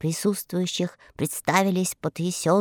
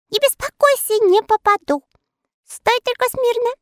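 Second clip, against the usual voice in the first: reassuring archer; 4 dB louder than usual. A narrator's reading. Archer reassures frightened Murzilka.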